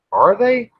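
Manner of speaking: usual speaking voice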